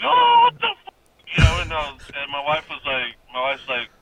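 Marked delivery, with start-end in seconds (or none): 0.0-0.9 loud, high startled yell; retold scream. Husband waking from a pinch, startled high yell. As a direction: jolt awake yelling in pain. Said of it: "I, like, wake up screaming".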